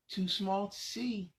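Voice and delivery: put-on lively read-aloud for little kids; reading to children. Adult reading a picture book to young children, lively storytelling. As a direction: read it out bright and animated for the kids.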